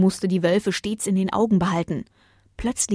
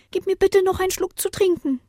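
put-on high child voice asking for a drink; small boy pleading for a sip, pitched well up; young boy voice; 12 semitones higher than usual. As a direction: voice the thirsty little boy high. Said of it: "sagte der Junge".

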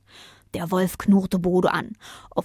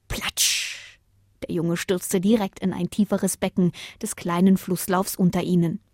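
plain reading voice

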